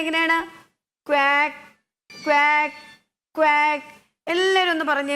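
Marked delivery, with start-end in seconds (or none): 1.1-3.9 three loud separate quacks; duck quack. Shown as a demonstration. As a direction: imitate a duck for the children. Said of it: "എങ്ങനെയാണ് താറാവ് ശബ്ദം ഉണ്ടാക്കുന്നത്".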